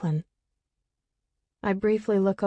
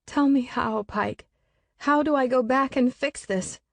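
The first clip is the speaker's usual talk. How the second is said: tearful pleading; 6 semitones higher, 3 dB louder than usual. A narrator's reading. Pleading to a hallucination.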